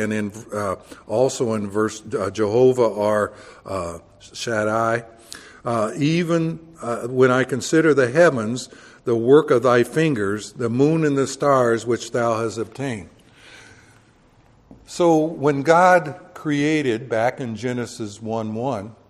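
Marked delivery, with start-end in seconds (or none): 6.0-13.1 preacher reading scripture aloud from the pulpit. Acted. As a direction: proclaim the verse to the congregation.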